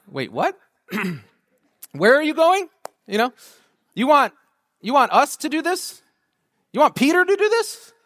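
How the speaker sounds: a flustered disciple hearing Jesus is leaving; incredulous disbelief. Retold or imitated. Preacher imagining himself at the Ascension, incredulous and rising.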